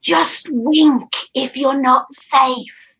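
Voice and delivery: very slow, drawn-out aside that everyone hears; conspiratorial but far too audible, each word stretched; loud would-be secret. Acted. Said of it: "she says it so loudly".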